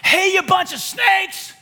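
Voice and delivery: high, slow, loud call-out from the riverbank; yelling. Retold or imitated. John yelling at his dad's friends, a loud prophet's call-out. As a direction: call them out like a fiery prophet.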